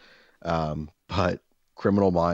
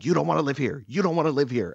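lightly mimicking a furious neighbor, higher pitch; retold angry warning, pitched up; mock furious; 8 semitones higher than usual. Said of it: "pointing them at me furiously".

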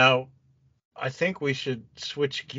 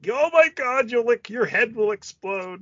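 gushing hype; 11 semitones higher, 4 dB louder than usual. Retold or imitated. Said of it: "and everyone had just, like".

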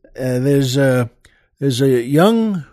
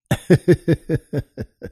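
a short laugh after the punchline; own laugh. Real, not acted. Host laughing at his own joke, a short laugh.

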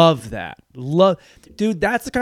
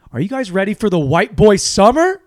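louder, higher hype call to the crowd; hype shout; 6 semitones higher, 8 dB louder than usual. Acted. Comic hyping the audience, a loud hype shout. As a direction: hype up the room like an MC.

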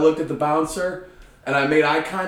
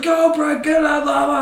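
much higher, louder cheering crowd; higher, louder mock crowd cheering Brett on; 12 semitones higher, 5 dB louder than usual. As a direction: chant him on like a rowdy crowd.

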